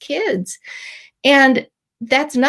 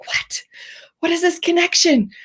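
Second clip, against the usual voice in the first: hushed gasp of a word, then high astonishment; stunned disbelief; 8 semitones higher than usual. Retold or imitated. Reacting to the Moldova link with her youth pastor, stunned. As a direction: start under the breath, then pitch up in amazement.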